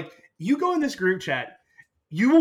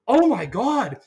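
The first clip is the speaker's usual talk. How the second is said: shocked waitress; 7 semitones higher, 6 dB louder, slower than usual. Retold or imitated. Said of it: "She was like".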